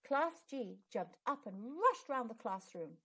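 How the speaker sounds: plain reading voice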